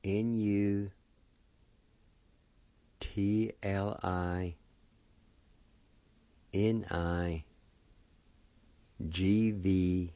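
slow letter-by-letter spelling with long gaps; spelled out. Shown as a demonstration. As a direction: spell it out a few letters at a time. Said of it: "it's about this".

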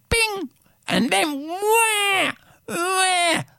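put-on high, drawn-out baby wah; baby wail. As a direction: wail like a newborn. Newborn crying in bucket.

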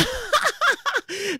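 high cackling laugh. Real, not acted. Host cracking up at her own menopause joke, high laugh. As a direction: let a high laugh burst out.

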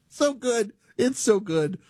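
high, laughing appreciation; delighted laughing. Real, not acted. Host relishing the Aussie voice's joke, delighted laughing. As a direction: savor the joke through a laugh.

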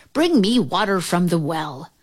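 ordinary delivery

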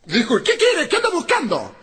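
high, yelled angry wife voice; shrill witch shout. Retold or imitated. Woman on the balcony yelling at the drunk, high screech.

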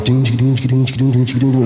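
chanted rhythmic machine dings; steady mouth-made ding-chicka beat; brain processing noises. Acted. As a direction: rattle off a little computing jingle. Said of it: "And the brain goes".

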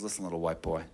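very low, quiet, menacing warning; angry defender warning the kid not to shoot; menacing threat. Retold or imitated. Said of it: "He says".

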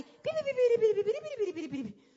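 pitidos agudos que imitan un radar. Retold or imitated. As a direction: piri piri, como un radar que busca señal. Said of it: "funcionaba como un radar". (la predicadora imita el radar de la atracción)

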